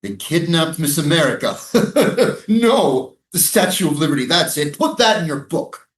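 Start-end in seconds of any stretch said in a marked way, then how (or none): 1.6-2.4 short scornful laugh; mocking laugh. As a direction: laugh off the question with contempt. Mocking a serious question.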